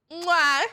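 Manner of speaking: a big, loud smacking air kiss; loud smacked kiss. Real, not acted. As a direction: blow the kiss loudly at the camera. Blowing kisses to viewers.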